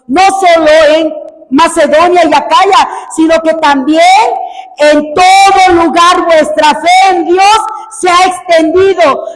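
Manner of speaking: loud, high proclaiming; rising pulpit proclamation; preaching. Acted. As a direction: build the verse into a shout. Building scripture reading to shout.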